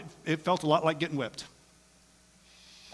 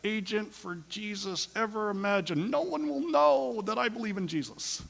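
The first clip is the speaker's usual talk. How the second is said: higher and softer, conspiratorial; mock secretive believer; 5 semitones higher, 7 dB quieter than usual. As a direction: confide your secret plan. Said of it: "you're like".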